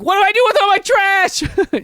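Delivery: high-pitched mock wail; mock helpless resident. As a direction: cry it out in exaggerated helplessness. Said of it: "You know, you're like".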